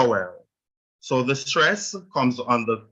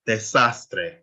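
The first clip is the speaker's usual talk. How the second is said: desastre with weight on "sas"; stress on SAS. Shown as a demonstration. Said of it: "you stress the A".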